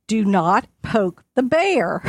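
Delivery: slow, drawn-out warning. Retold or imitated. Husband warning the kids off an angry mom, slow. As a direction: lay down the law slowly.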